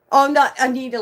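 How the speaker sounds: thrilled recognition; excited shout. Real, not acted. Streamer greets friend Anita in chat, excited shout.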